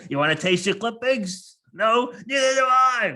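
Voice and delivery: mock furious yell, high and loud; Tony Soprano. Imagined threat to the toenail trimmer, yelling. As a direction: yell it like an enraged tough guy, building. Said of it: "just start yelling and screaming".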